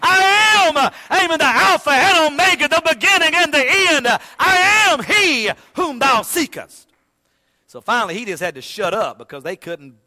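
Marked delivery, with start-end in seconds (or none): 0.0-6.9 full-force preaching. Acted. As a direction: shout it out like a revival preacher.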